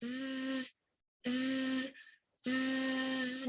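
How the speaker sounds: short soft buzzing z; buzzing z sound. Shown as a demonstration. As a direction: buzz through closed teeth. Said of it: "Letter Z says".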